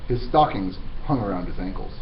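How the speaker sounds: plain reading voice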